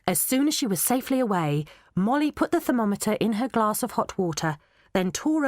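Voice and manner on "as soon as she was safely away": usual speaking voice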